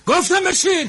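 enraged shout at the man who won't sit; furious shout. Acted. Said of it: "خب داد نزن".

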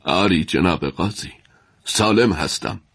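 shaky reply from a scared prisoner; trembling voice. A narrator's reading. Abu Dulaf answers the judge in a trembling voice. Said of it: "ابودلف با صدای لرزان گفت".

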